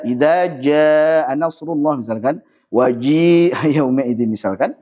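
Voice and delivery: "jaa" pushed too far back, dry with no wetness; forcing a stiff, over-dry jim; overdone jim. Shown as a demonstration. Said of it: "takalluf, memberat-beratkan". (jim dibuat terlalu kering dan berat)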